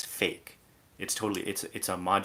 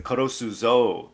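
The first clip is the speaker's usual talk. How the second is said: loud, higher mock gangster threat; sudden yakuza-style threat; 4 semitones higher, 10 dB louder than usual. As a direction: jump straight from polite to menacing, imitating a threat. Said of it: "from zero to ninety".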